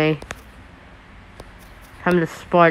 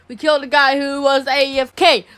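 normal talking voice

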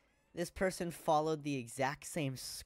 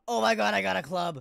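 loud and higher, thrilled; excited shout; 6 semitones higher, 10 dB louder than usual. Real, not acted. Excited repeat of find.

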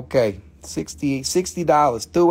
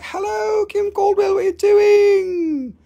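very high, drawn-out mock hail; squeaky-high, exaggerated chorus of hello; mock group greeting; 3 dB louder, slower than usual. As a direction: sing it out high and stretch the last word. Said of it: "we can all be like".

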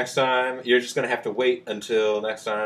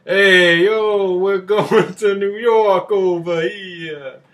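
loud, slow New York tough guy; brash Noo Yawk street call; New York accent; 9 semitones higher, 9 dB louder, much slower than usual. Pretend. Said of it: "not gonna do this for every location".

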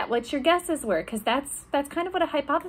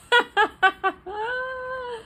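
a bright, high burst of laughter at her own mishap; real laugh; 12 semitones higher than usual. Real, not acted. Knocked house down by accident.

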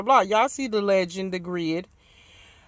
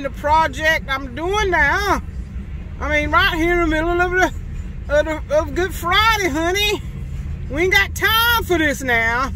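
louder, higher complaining to the camera; real irritation, raised voice; annoyed; 8 semitones higher, 7 dB louder than usual. Real, not acted. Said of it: "I am so annoyed".

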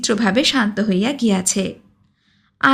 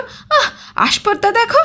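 higher, crying edge on the outburst; tearful outrage; 11 semitones higher than usual. Acted. Blacksmith alone after the quarrel, voice cracking with tears. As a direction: voice cracking into a wounded cry.

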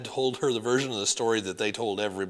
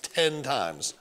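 ordinary delivery

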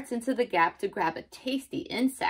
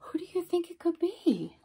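high, airy stage whisper; playful whispered guessing game; hushed wondering; 9 semitones higher than usual. Acted. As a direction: whisper the question like a secret. Secretive question to child listeners.

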